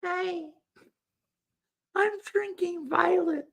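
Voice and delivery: put-on very high girly teen voice; valley girl voice. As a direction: squeak it out like a bubbly teen girl. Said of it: "do you have a voice like this?".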